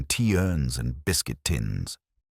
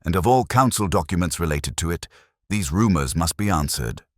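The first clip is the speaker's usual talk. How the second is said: ordinary delivery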